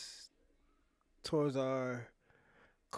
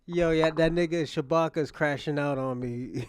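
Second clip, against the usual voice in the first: louder, amused announcement; laughing loud call-out; 8 dB louder than usual. Real, not acted. Streamer reacting to Shabaka's rant, loud and amused. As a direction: shout it out, laughing at him.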